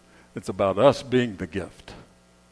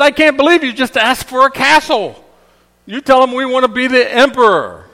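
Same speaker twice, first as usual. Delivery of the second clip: scornful wife; 14 semitones higher, 13 dB louder than usual. Retold or imitated. Wife scolding after the castle.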